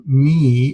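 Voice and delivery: "me" stressed and held for contrast; emphatic me. Shown as a demonstration. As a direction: lean on "me" to set it against others. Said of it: "adding some sort of emphasis".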